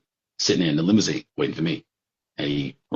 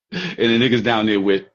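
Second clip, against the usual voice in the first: ordinary delivery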